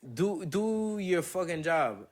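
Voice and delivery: "Do your fucking job" drawn out, each word hit; slow emphatic demand. Real, not acted. Telling staff what they owe detainees, slow and stressed. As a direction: spell out "job" slowly and hard. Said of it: "repeats slower, stressed".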